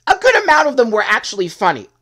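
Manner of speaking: loud, high emphatic insistence. Real, not acted. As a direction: push it out loud and high, stressing GOOD. Stresses the point, then repeats it calmer.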